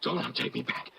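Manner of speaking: breathless, pleading whisper; frightened whisper. Acted. Hunted brother begging not to be taken back, whispered. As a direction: beg in a hushed panic. Terrified fugitive begs sister.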